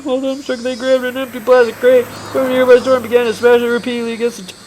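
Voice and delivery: ordinary delivery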